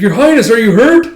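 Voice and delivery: high, loud anxious question; a retainer calling to the princess, high and loud; worried retainer. Acted. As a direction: rush it out in worry.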